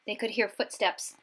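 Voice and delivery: usual speaking voice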